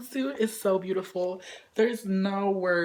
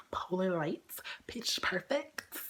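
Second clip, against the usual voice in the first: whisper; 2 semitones lower, 7 dB quieter than usual. Real, not acted. Whispered photobook name.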